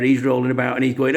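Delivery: ordinary talk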